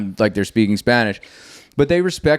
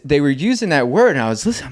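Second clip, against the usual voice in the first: ordinary delivery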